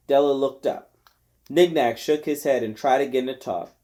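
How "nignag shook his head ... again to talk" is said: usual speaking voice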